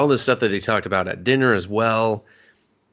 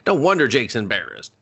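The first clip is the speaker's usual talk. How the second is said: ordinary talk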